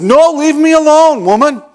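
high, loud irritated bark; mad snap. Retold or imitated. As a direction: snap at his wife to back off. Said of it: "and I was mad".

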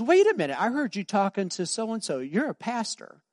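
high eager stranger voice; surprised stranger. Retold or imitated. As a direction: catch him out, high and surprised. Stranger realizes he's a pastor.

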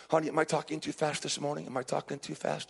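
ordinary talk, nothing marked

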